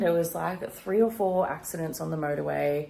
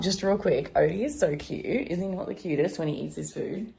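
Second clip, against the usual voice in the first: normal talking voice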